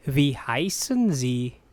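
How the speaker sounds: unhurried teaching model. Shown as a demonstration. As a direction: ask it word by word. Teacher repeats slowly for learners.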